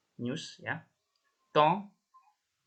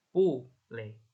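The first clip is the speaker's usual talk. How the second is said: ordinary talk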